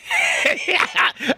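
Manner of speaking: high shrieking burst of laughter. Real, not acted. Vlogger watching a lawyer get scolded, shrieks with laughter. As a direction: lose it in a high cackle. Cracking up at the judge clip.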